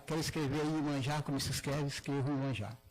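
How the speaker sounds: usual speaking voice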